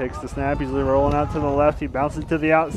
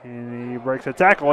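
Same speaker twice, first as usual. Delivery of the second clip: ordinary delivery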